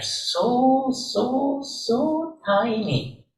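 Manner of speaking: slow, soft, drawn-out "so, so tiny". Acted. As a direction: stretch each "so" to make us feel small. Stretching "tiny" to show smallness.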